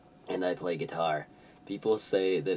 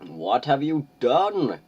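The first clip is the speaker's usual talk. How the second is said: high, loud, slow hammed-up demand; mock anger; 8 semitones higher, 9 dB louder, slower than usual. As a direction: bellow it like a furious headmaster. Acting Dumbledore's angry line.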